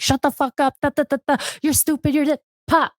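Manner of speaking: mock village husband. Mocking a hardcore Albanian husband talking down to his wife, high mock voice.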